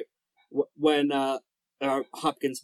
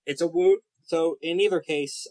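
normal talking voice

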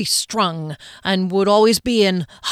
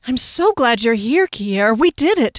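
higher, delighted exclamations; broad grin; 4 semitones higher, 4 dB louder than usual. A narrator's reading. Fennel greets Kier at the door, grinning and thrilled. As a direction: welcome them with mounting excitement. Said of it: "He was grinning broadly".

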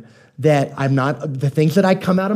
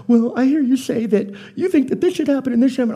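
wife voice; 9 semitones higher than usual. Retold or imitated. Wife Kelly voiced in story.

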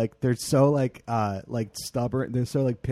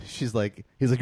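ordinary talk, nothing marked

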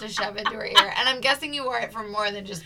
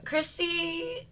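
usual speaking voice

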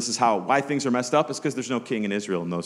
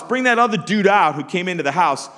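raised, higher-pitched demanding call; mob's demand; 8 semitones higher, 5 dB louder than usual. Acted. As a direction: call out loudly as a mob at the door. Mob demands guest from old man.

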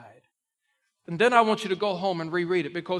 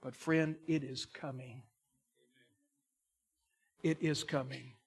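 softer, low and ominous; hushed warning; 4 semitones lower, 8 dB quieter than usual. Acted. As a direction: drop to a near hush, let it sink in. Hushed warning after loud build.

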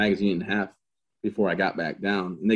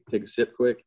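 ordinary delivery

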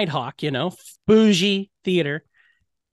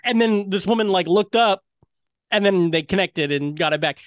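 usual speaking voice

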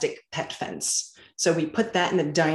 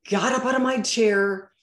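ordinary delivery

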